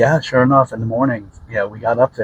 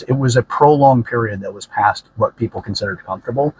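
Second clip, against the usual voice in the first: usual speaking voice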